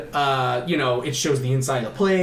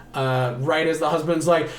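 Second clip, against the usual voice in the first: ordinary delivery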